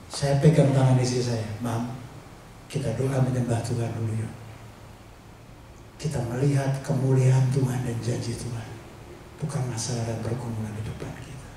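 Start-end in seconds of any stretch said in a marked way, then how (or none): 1.6-11.5 soft, low retold reassurance; gentle urging. Husband urging his weary wife to pray, soft and low. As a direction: quietly coax her to pray.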